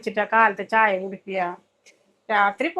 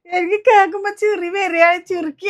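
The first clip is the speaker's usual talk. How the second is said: laughing at him; 5 semitones higher, 4 dB louder than usual. Acted. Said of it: "ഉമ്മ ചിരിക്കുക അല്ലേ".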